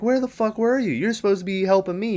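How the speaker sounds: high, fast, indignant outburst; angry retort. Retold or imitated. Fake Gale scolding Dewey for not helping, fast angry pitch. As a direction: snap at him for not helping.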